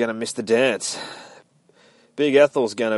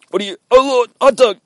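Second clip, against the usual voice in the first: much higher, louder shocked yell; startled cry; 12 dB louder than usual. Acted. Jughead cries out as Hot Dog lunges at him, high shocked yell.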